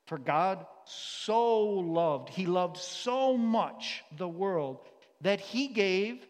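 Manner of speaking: drawn out, each word weighed and loud; slow scripture reading. Acted. Preacher reading John three sixteen slowly aloud. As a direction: read the verse slowly and heavily.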